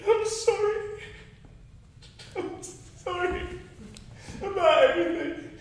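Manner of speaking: halting, tearful apology; breaking down. Acted. Apologizing to a friend about Dickie, haltingly tearful. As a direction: struggle to speak through rising tears. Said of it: "if you didn't break down like this".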